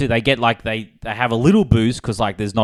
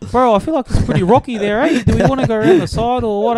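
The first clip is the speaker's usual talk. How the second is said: mock Kiwi soldier; 12 semitones higher, 4 dB louder than usual. Kiwi soldier questioning landing spot.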